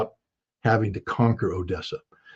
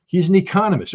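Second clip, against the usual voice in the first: ordinary talk, nothing marked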